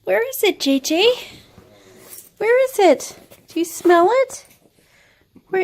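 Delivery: high, loud coaxing dog voice. Real, not acted. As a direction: coax the dog in a squeaky voice.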